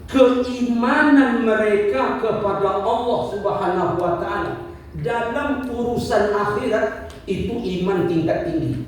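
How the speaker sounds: usual speaking voice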